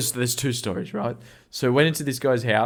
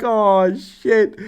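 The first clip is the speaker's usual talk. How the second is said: high, loud, laughing groan of defeat; laughing dismay; 11 semitones higher, 8 dB louder than usual. Retold or imitated. Storyteller reliving being sent to the van, laughing loud dismay. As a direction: let the dismay burst out through laughter.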